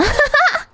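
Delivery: sudden high-pitched cackle; squeaky high laugh. Pretend. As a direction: burst into a squeaky giggle. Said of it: "my laugh would be that".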